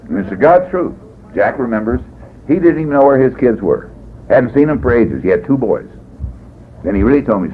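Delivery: ordinary talk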